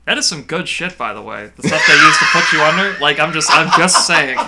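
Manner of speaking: loud and laughing; laughing loudly. Real, not acted. Enthusing about anesthesia.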